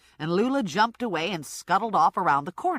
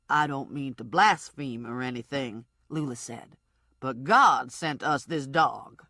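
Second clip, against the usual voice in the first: Lula; 6 semitones lower than usual. Acted. Lula's voice in the story.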